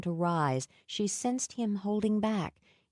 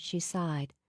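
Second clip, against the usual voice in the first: plain reading voice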